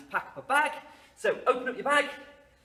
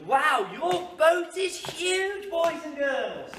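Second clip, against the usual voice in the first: slow, high, louder wow; amazed praise; 9 semitones higher, 5 dB louder, slower than usual. Acted. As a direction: marvel at the pupils. Praising pupils.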